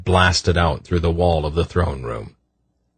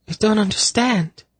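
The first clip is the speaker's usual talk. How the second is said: put-on high, light girl's voice; young woman voice. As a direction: raise the pitch for a young woman. Zinedra baffled.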